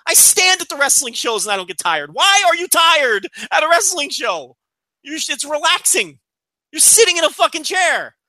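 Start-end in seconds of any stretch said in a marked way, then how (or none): 2.1-8.2 raised, high-pitched incredulous complaint; worked-up, emphatic exasperation; exasperated rant. Real, not acted. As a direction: rant in disbelief at these people. Exasperated rant at tired fans.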